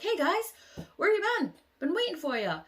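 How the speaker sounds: carefree hello; breezy Harry. Retold or imitated. Harry coming out of the bathroom, high breezy greeting. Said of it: "He's like".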